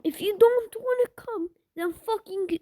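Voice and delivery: high, loud, cracking shout; frustrated yell. Real, not acted. Gamer yells at a lagging teammate, high and cracking.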